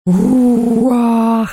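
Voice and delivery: slow gloating ghost laugh; triumphant cackle. Acted. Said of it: "торжествующе вопило оно".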